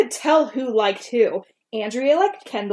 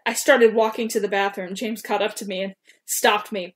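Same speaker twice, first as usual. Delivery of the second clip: usual speaking voice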